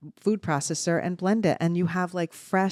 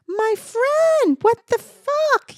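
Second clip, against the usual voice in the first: put-on loud, high, drawn-out North Bergen Jersey accent; hammed-up Jersey accent, slow and high; Kim's Jersey accent; 7 dB louder, much slower than usual. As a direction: belt it out as a brash North Jersey friend impression. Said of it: "wish I could do her accent".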